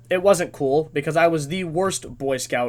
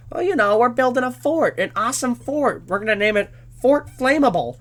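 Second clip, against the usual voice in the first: put-on bluffing scout boys; 6 semitones higher than usual. Boys bluff the suspicious leader.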